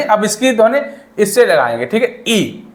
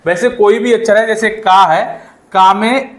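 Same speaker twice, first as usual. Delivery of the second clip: ordinary delivery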